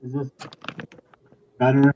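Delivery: pushing the voice to be heard. Real, not acted. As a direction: raise the voice into the mic. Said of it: "I think that's a little better".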